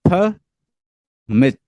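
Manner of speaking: "फ" and "मिट" as two separate loud sounds; syllable by syllable. Shown as a demonstration. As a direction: sound out "फ", long pause, then "मिट". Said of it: "sound से देखना है".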